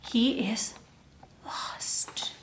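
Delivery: softly spooky storyteller hush; hushed reveal. A narrator's reading. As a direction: drop to a near whisper on lost. Dramatic hushed reveal to kids.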